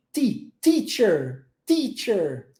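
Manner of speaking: teacher drawn out slowly, then said once clean; slow it down, then say it whole; slow English teacher. Shown as a demonstration. Teacher drilling the word, slow then whole. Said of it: "Você encosta a língua atrás do seu dente".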